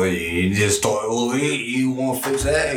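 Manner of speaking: garbled, barely intelligible British speech; mock British accent. Cohost imitates unintelligible UK speakers, British accent. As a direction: run the words together in fake British. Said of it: "people in the U K are like".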